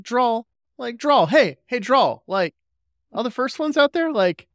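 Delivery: high, wheedling mock Delenn; Delenn's pleading voice. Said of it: "Delenn's all like".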